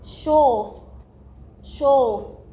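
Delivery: "شوفت" with a long vowel, set apart by pauses; teacher carefully modelling "شوفت" for the class; pronunciation demo. Shown as a demonstration. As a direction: sound out "شوفت" as one drawn-out word. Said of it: "فهنقولها".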